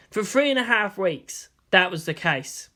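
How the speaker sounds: usual speaking voice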